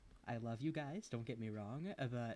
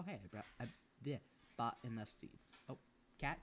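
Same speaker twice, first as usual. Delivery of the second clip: usual speaking voice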